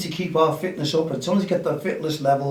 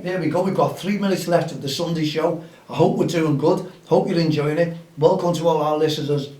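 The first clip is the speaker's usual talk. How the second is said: ordinary talk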